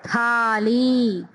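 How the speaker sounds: थाली drawn out with breathy थ; slow aspirated थाली; slow model reading. Shown as a demonstration. Teacher modelling थाली. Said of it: "थ की आवाज आ रही है".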